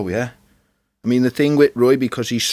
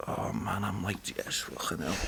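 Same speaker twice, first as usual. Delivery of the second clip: much softer, muttering to himself; quiet inner thought; 14 dB quieter than usual. Retold or imitated. Voicing his thoughts while watching Peep Show, much softer. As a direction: say it like a private thought under your breath. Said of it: "just think".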